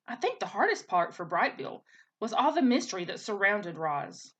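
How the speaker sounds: ordinary delivery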